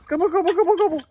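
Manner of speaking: high, fast gobble-gobble to the birds; turkey gobble call. Acted. Farmer calling his turkeys in a high turkey gobble.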